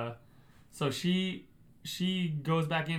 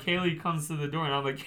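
ordinary talk, nothing marked

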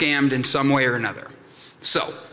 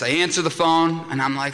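normal talking voice

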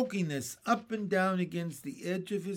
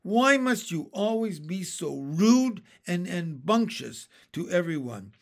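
loud, emphatic telling-off; outraged earthworm's reprimand; indignant scolding; 3 semitones higher, 8 dB louder than usual. A narrator's reading. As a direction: berate the rude one and demand an apology. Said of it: "cried the earthworm".

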